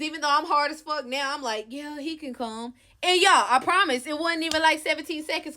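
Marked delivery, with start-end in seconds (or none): 1.7-2.7 a soft, slow yes. Retold or imitated. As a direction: give in gently. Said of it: "my little soft ways".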